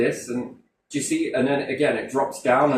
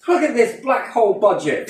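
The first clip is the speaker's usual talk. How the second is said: indignant outburst; 10 semitones higher, 6 dB louder than usual. Real, not acted. Ranting about the budget.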